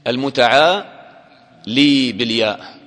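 drawn-out المتعالي with the final ya sounded; spelled-out slow المتعالي; slow, emphatic. Shown as a demonstration. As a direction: stretch المتعالي slowly so the ياء is heard. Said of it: "أصلها إيش؟".

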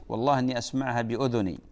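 ordinary delivery

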